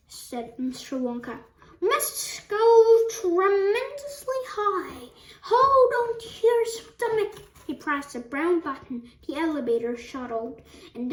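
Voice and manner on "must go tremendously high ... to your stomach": loud, rising-energy warning; excited urging. A narrator's reading. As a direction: call it out with relish. Wonka urging the elevator higher.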